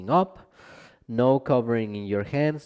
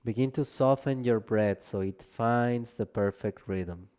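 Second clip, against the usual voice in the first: calm Yoga Nidra guiding voice with long pauses; guided meditation; 4 dB quieter than usual. Real, not acted. Teacher leading listeners into Yoga Nidra rest, calm guided meditation. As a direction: ease them into rest, gently, leaving space between phrases.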